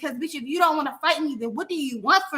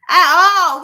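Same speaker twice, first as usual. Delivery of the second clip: loud emphatic repeat; 3 semitones higher, 11 dB louder than usual. Real, not acted.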